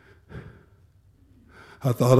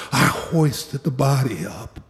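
ordinary talk

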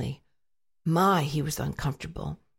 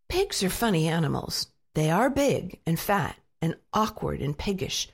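ordinary delivery

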